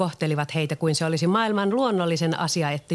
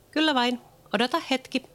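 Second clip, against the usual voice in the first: put-on higher girl's voice, eager; 8 semitones higher than usual. Leena answers Peter at the window, higher girl's voice. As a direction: answer Peter as a bright young girl. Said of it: "Leena vastasi".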